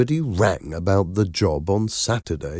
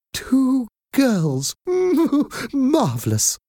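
very high, gushing delight with a giggle; enthusiastic; slower than usual. A narrator's reading. Nanny delighted by the children, enthusiastic. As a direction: gush in a high, thrilled voice. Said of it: "the caller said enthusiastically".

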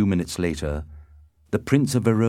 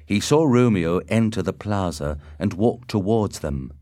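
usual speaking voice

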